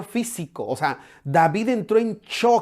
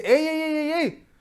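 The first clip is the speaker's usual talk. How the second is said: high, scolding voice of an exasperated general; preacher voicing Joab scolding the king, pitched high; 8 semitones higher than usual. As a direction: play Joab telling David off, up in pitch. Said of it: "y le dice".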